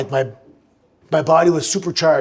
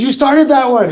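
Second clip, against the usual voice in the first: high, loud excited accusation; excited brother; 10 semitones higher, 7 dB louder than usual. Retold or imitated. Brother at the arena, thrilled that the chant caught on, loud and excited. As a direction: shout it like a thrilled kid brother. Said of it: "my brother looked at me and goes".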